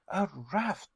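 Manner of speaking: high, disbelieving exclamation; incredulous cry. A narrator's reading. Nephew hearing of the raft, incredulous high cry. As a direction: blurt it out, not believing it. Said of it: "I cried incredulously".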